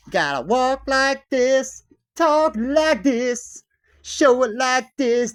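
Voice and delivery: high, slow, drawn-out mocking voice; mock business instructor. Business course teacher giving rules, mock instructor voice. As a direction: parody a coach, each command stretched out.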